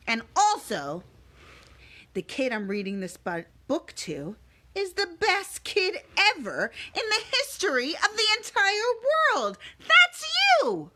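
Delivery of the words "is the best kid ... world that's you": building excitement. Acted. Building up to praise kid.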